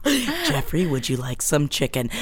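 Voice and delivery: hushed creepy invitation; soft creepy voice. Shown as a demonstration. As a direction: coo it like a psychopath. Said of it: "if I started talking like that".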